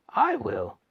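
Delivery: put-on high eager old man's cry; eager shout. Grandpa Joe volunteering to go, high eager cry. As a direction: jump in with it. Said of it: "shouted Grandpa Joe".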